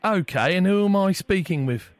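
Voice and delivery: hammed-up English caller; poor English accent. Prank caller rings a cafe, poor English accent. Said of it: "disregard the piss poor English accent".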